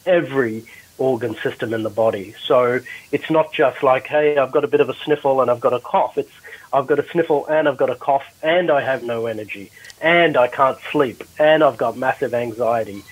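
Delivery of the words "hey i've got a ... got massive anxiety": ordinary talk, nothing marked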